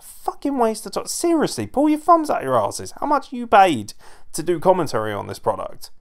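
high-pitched exasperated tirade; angry rant. Real, not acted. Fan scolding paid commentators for wasting time, exasperated.

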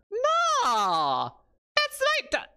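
high, loud drawn-out protest; dismayed cry. Pretend. Streamer protesting at the sight, high dismayed yell.

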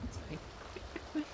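a breathy whisper to herself. Real, not acted. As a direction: whisper it, almost to yourself. Repeating it's fine to herself.

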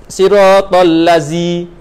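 'Siratal lazi' with z instead of dh, slow; 'lazi' said with a plain z sound; wrong Arabic reading. Shown as a demonstration. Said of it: "ni berubah makna".

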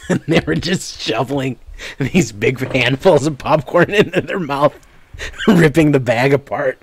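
high, loud, laugh-choked storytelling; helpless laughter breaking through the words; laughing while talking. Real, not acted. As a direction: crack up as you tell it. Cracking up while telling story.

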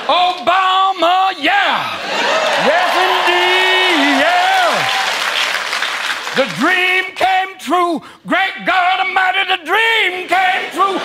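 high, slow, shouted preaching; gospel preacher. Pretend. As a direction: testify to the crowd, drawn out.

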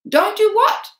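high, loud baffled question; Hubert puzzled. A narrator's reading. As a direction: snap back in confusion. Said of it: "said Hubert".

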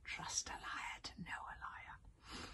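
slow sly whisper. Acted. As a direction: hiss it softly and slowly. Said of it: "whispered Stormfly and Dragonese".

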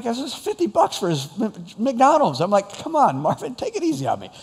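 high-pitched, pleading disbelief; mock-distressed plea to go easy; exasperated protest. Acted protest at costly order.

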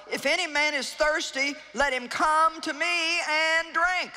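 lightly voiced call to the thirsty; mild cry of Jesus. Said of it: "he cried out in a loud voice".

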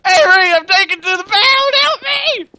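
high hammed-up crying plea; mock panic. Cat player begs his friend for rescue in panic. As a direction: wail like a captured cat.

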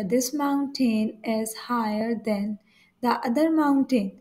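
very slow, deliberate reading; slow example reading. Shown as a demonstration. Teacher reading a comparison example to students, very slow. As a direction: spell it out slowly for learners. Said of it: "teacher reads out example sentence".

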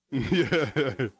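chuckled agreement; laughing. Real, not acted. Teacher amused by his point on the Greek word, laughing. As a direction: laugh through a short yeah.

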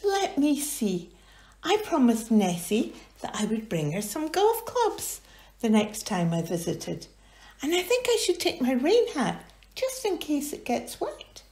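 high thoughtful character voice; Jeannie voice. Acted. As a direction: muse about what to pack.

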